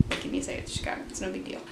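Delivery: very quiet, half-voiced shrug; muttered aside. Real, not acted. Fan brushes off the loss to Chicago, muttering. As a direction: drop to a near-whisper, dismissive.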